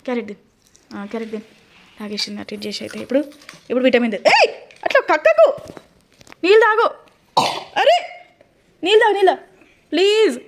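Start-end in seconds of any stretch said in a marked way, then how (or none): 4.4-5.5 sudden high, loud cry with a laugh; alarmed laughing cry. Real, not acted. Child spitting out medicine. 6.4-10.4 high, loud, urgent begging; urgent pleading. Real, not acted. Urging child to drink water.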